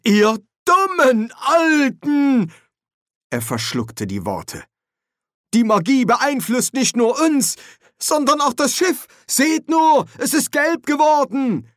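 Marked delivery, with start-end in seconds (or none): 0.0-2.5 much higher, louder, slow furious cry; exasperated outburst. Acted. Ponder scolds the old wizards, loud and slow. 5.5-11.7 much higher, louder, pressing alarm; urgent young wizard. Acted. Ponder shows the wizards the yellowing deck, urgent and high.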